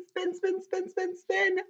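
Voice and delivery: high, quick repeated chant; playful urging. Acted. Storyteller gets kids spinning fingers, high playful urging. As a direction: cheer little fingers round in a high voice.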